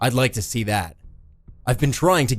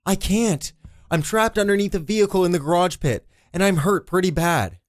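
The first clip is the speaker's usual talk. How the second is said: high, strained call for help; 8 semitones higher than usual. A narrator's reading. Eddie, hurt in the pit, answers the cop, calling out high. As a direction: call up from under a vehicle. Trapped injured man calls back.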